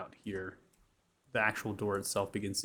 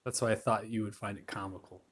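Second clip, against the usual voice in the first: normal talking voice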